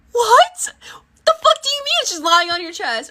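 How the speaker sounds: high disbelieving yelp; stunned outburst; shocked, high-pitched. Retold or imitated. Her shocked reply, retold.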